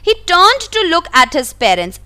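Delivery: slow, careful read-aloud of the story. A narrator's reading. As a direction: read each word out slowly for the class. Reading the English story text. (धीमी आवाज़ में कहानी पढ़ना)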